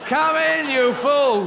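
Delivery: loud, high welcoming shout; calling out. Acted. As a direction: holler the fool inside. Calling the fool in once more.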